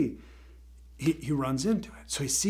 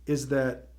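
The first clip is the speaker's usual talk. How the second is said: usual speaking voice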